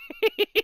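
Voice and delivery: sudden squeaky laugh; a quick high burst of laughter. Real, not acted. Laughing at sign joke.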